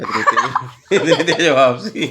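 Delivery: laughing. Real, not acted. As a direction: crack up while telling it. हँसते हुए किस्सा सुनाना. (हँसी में फूटते हुए)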